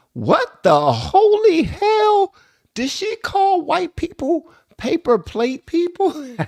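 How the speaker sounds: high, drawn-out disbelieving squeal; incredulous laughter. Real, not acted. Vlogger reacting to "paper plate people", incredulous laughter. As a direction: shriek it slowly, breaking into real laughter. Cracks up at the played clip.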